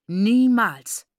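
loud, drawn-out shout; furious cry. A narrator's reading. Father striking his daughter, furious shout. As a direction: roar the word out. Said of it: "rief er".

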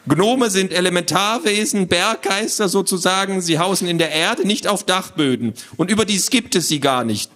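high, lecturing little creature; squawky know-it-all. Acted. Little man explains gnomes to children, squawky lecturing. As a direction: lecture them in a fussy squawk. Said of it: "eine quäkende Stimme".

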